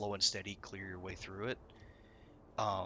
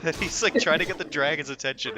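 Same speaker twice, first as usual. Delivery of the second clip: ordinary talk, nothing marked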